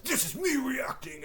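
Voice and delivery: very high, put-on mimicking voice; mock reaction. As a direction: imitate a reaction with pitch shot up. Mimics Josh reacting defiantly.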